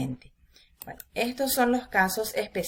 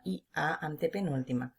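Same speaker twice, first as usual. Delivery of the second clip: ordinary talk, nothing marked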